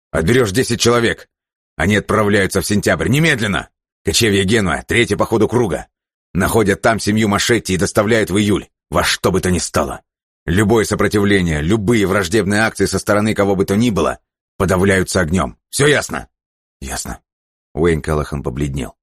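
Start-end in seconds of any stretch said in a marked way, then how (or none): 0.1-16.3 raised, forceful military command; furious orders. Acted. Джерри в бешенстве отдаёт приказ. 16.8-17.2 subdued acknowledgement. Acted. Подчинённый подавленно принимает приказ.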